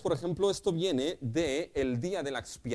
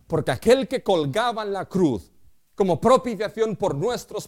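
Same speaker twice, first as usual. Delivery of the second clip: louder, preaching at full force; fiery preaching; 5 dB louder than usual. Acted. Pastor proclaims to the church, loud preaching. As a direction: drive the point home to the congregation.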